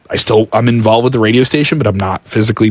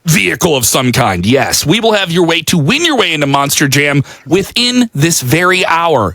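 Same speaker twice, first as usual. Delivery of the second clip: monster truck ad; 6 semitones higher than usual. Shown as a demonstration. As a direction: sell the monster truck show like a hyped radio promo. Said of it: "I have to use this voice all of the time".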